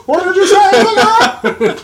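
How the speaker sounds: loud, slow disbelief rising to a squeal; mock shock.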